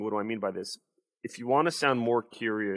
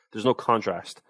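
normal talking voice

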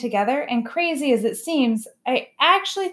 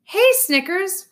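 put-on happy young elephant; 10 semitones higher, 4 dB louder, slower than usual. A narrator's reading. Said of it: "Ruby says".

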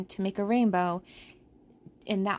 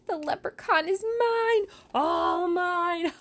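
high, gleeful greedy gloat; 8 semitones higher than usual. Acted. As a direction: savor the win like a villain. Olivia gloats over catch.

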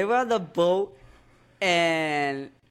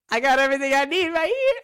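high, louder, impassioned movie line; imitated character quote; 8 semitones higher, 5 dB louder, faster than usual. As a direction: deliver it high and loud, as the character.

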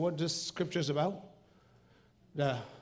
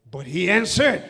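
ordinary talk

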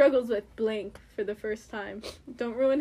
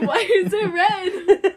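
slow words through laughter; laughing; 6 semitones higher, 8 dB louder, much slower than usual. Real, not acted. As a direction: laugh out the question. Laughing repeat of question.

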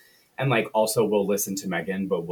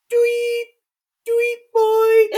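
put-on very high, loud sing-song call to a dog, slowed on "boy"; shrill-pitched neighbour hollering for her dog across the yard; Celia calling the dog; 10 dB louder, slower than usual. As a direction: mimic a woman calling her pet home, way up high and loud with a laugh in it. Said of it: "It sounds exactly like this. This is my favorite thing to do".

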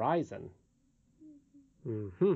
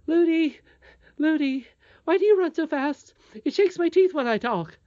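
put-on high, loud little-girl voice; chattering princess; 8 dB louder than usual. A narrator's reading. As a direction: call out as a small princess, pestering her nurse. Princess chatters while running.

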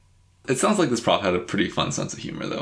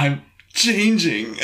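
high, slow, drawn-out mock wail; mock transformation; 8 semitones higher, much slower than usual. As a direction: pretend you're turning into a dog. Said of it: "like, woofed or something".

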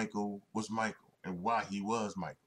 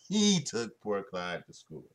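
amused relish; 4 dB louder, slower than usual. Real, not acted. Repeats own line, amused, drawn out.

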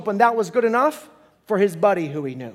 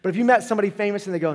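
usual speaking voice

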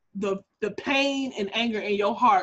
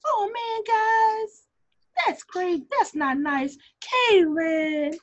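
Jasmine voice; 10 semitones higher, 4 dB louder, much slower than usual. Acted. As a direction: put on a high, drawn-out, mildly chiding voice. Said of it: "sounds like this".

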